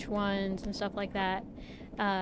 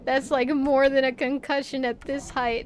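loud, high, alarmed warning; retelling the panic of watching him fall; panicked alarm; 4 semitones higher, 6 dB louder than usual. Retold or imitated.

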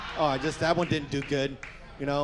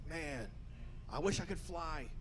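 much softer, dreamy inner wish "I wish I could fly."; wistful thought; 12 dB quieter than usual. Retold or imitated. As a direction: say it softly, longing. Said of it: "I thought to myself".

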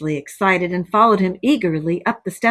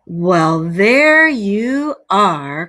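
loud, slow showing-off; proud cry; 5 dB louder, much slower than usual. A narrator's reading. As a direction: present it like a grand prize, loudly. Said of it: "cried the Toad".

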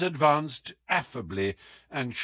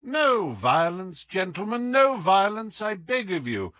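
raised, pleading cry for calm; loud, hands-up plea to stop the fight; urgent plea; 8 semitones higher, 5 dB louder than usual. Acted. Holmes urging the Count to hold back, loudly pleading.

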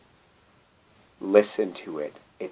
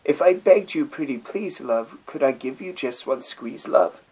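higher, sweetly pleading turtle voice; courting turtle; 6 semitones higher than usual. Acted. Turtle wooing the silent bagpipe, higher and sweetly pleading. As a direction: coax her like a lovesick turtle. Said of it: "Said the turtle to his darling".

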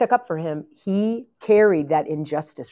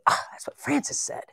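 whispered admission; 8 semitones higher, 6 dB quieter than usual. Retold or imitated. Elders admit they were warned.

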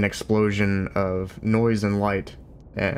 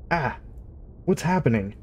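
higher, startled cry of a bewildered whale; 5 semitones higher than usual. A narrator's reading. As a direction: gasp it out in sudden alarm. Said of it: "it thought".

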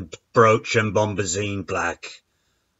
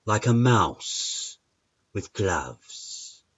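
soft, slow and fading; hushed; 6 dB quieter, slower than usual. A narrator's reading. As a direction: tiptoe the words like a mouse. Mouse image said tiny and soft.

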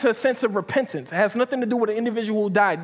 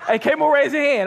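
laughing, calling out louder and higher; 5 semitones higher, 4 dB louder than usual. Real, not acted. Preacher laughing, calling on Camo in the pews. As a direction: tease him through a laugh.

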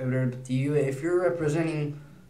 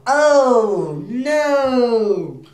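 disgusted oh no; 9 semitones higher, 11 dB louder than usual. Retold or imitated. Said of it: "and then you'll smell".

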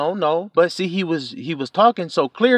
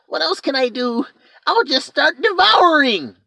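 scheming dragon; 11 semitones higher than usual. Retold or imitated. Dragon plotting.

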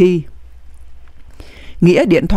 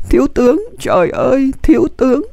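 raised, understated wail of grief; mild sobbing cry; 6 semitones higher than usual. A narrator's reading. Aide cries out over the dying general, restrained narrated wail. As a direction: lightly voice a distraught cry, pitched higher. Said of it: "Nghĩa gào lên nức nở".

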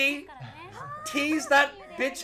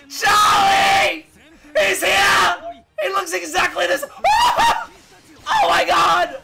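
loud, high, overwhelmed shouting; real fan meltdown, yelled; ecstatic yelling; 5 semitones higher, 7 dB louder than usual. Real, not acted. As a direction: scream it with joy. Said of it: "I'm yelling so much".

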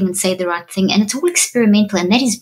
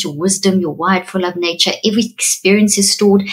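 ordinary talk, nothing marked

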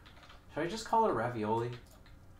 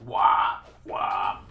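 sharp loud wah yells; yelling wah; 5 semitones lower, 10 dB louder than usual. Real, not acted. Gamer yelling wah as the sword swings. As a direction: bark out wah with each swing.

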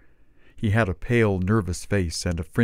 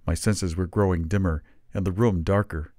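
ordinary delivery

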